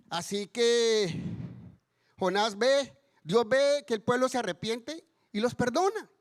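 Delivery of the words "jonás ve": usual speaking voice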